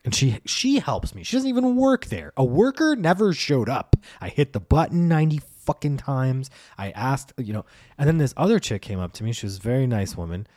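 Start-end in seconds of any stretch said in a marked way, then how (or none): none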